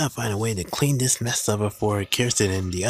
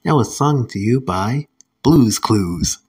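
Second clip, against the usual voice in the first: loud, punchy presenter voice; upbeat, projected kids' show emcee; show host announcement; 3 semitones higher, 9 dB louder than usual. Acted. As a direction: announce the next clip with big energy. Host introduces music clip.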